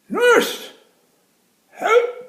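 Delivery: very high, loud cry for the nurse; calling for help. Acted. Jim calling the nurse, very high and loud. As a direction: wail it down the corridor.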